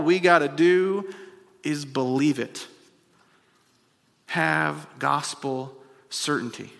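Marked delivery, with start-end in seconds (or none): 4.3-6.7 soft, slow and falling, "Have gospel certainty" said gently; hushed conviction on "Have gospel certainty"; quiet. Real, not acted. Same phrase later preached loud.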